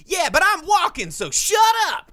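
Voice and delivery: very high, loud dismissive yell; mock shout. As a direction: shriek it like a rude pedestrian. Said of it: "and she's like".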